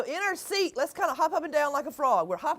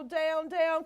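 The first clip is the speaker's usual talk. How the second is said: ordinary delivery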